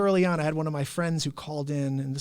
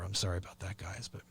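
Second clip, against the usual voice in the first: softer whispered apology; whispered sorry; 8 semitones lower, 11 dB quieter than usual. Real, not acted. Host apologizing for running long, whispered. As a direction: whisper it to the audience.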